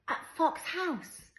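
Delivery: high, loud, slow squeal of a question; delighted child. A narrator's reading. Child lights up at going to Granny's, delighted. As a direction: burst out with drawn-out glee. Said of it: "Skye's face lit up".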